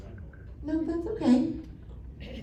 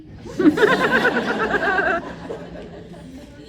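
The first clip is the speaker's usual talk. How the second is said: a long, high burst of laughter; laughing helplessly at the brother's antics; real laughter; 9 semitones higher than usual. Real, not acted.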